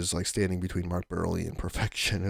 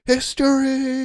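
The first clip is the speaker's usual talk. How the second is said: very high, loud announcer call; Hawk Harrelson call; 7 dB louder, slower than usual. Retold or imitated. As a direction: proclaim it like a broadcaster at the big moment. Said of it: "Hawk is like".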